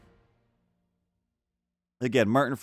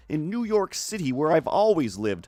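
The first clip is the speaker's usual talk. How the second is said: hammed-up grating American accent, pitched higher; Doctor Stephen Strange; 5 semitones higher than usual. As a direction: put on a laboured American accent, like just learning to talk. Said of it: "with his American voice ... grates on me".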